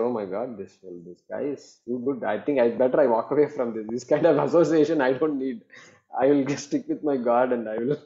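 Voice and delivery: fast, hurried mock reaction of someone backing away; mock worried people. Teacher mimics people fleeing a lofty claim, rushed. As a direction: rattle it off like a spooked listener. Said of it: "People will say".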